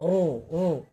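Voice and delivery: a short rolled "রু", drawn out slowly at the end; ra sound demo. Shown as a demonstration. Teacher demonstrating the ra echo sound, slow rolled "রু". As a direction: say "রু" with a light roll, slowing down. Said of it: "এই যে রয়ের দেখছেন".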